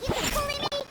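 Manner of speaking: taunt. Acted. Battle taunt mid-fight.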